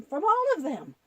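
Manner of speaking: ordinary delivery, nothing marked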